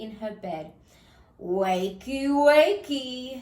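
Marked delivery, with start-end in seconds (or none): 1.4-3.4 rising, louder sneering wakey call; snarled. Acted. Wolf waking Sleeping Beauty, a rising snarl. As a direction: snarl it, getting louder on the second. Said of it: "he snarled".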